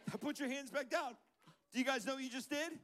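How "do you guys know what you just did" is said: ordinary talk, nothing marked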